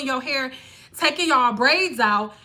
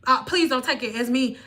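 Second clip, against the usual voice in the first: ordinary talk, nothing marked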